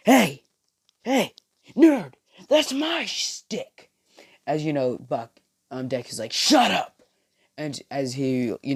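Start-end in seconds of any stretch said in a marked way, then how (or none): 0.1-3.9 much higher, slow and strained mock yell; a rival barking at a nerd, drawn out high; Bakugo yelling. Bakugo confronting Deku over his quirk, high mock yell. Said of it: "He's like". 6.3-7.0 snapping shout. Retold or imitated. Deku snapping back at Bakugo.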